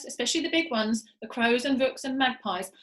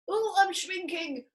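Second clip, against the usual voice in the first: slow, higher burbling moan; 7 semitones higher, slower than usual. Acted. Mister Twit burbles as he shrinks. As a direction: burble it in dismay. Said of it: "burbled Mister Twit".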